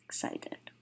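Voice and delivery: breathy whispered aside, no voice at all. Acted. Teacher to students, softly glossing the word in a whisper. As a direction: let the word slip out as a secret.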